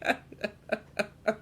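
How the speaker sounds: high staccato laugh; helpless high giggle at your own blunder; laughing at own slip. Real, not acted. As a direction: burst of high ha-has.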